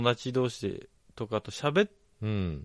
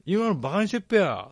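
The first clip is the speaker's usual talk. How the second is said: Ibaraki dialect imitation; 7 semitones higher, 4 dB louder than usual. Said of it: "って言わない".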